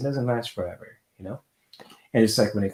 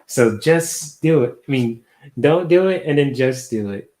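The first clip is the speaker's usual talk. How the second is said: loud motivational shout; 5 semitones higher, 9 dB louder than usual. Acted. Tarot reader barks motivational slogan.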